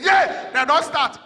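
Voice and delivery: loud, high-pitched warning yell; shouting. Retold or imitated. Running man reaching the waiting crowd, shouting again. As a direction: holler it as a sudden alarm. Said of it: "he shouted again".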